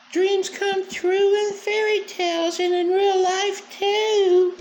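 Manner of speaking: very high, sugary mock little girl voice; mocking childish girl voice.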